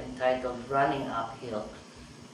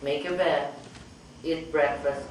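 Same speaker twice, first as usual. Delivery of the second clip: ordinary delivery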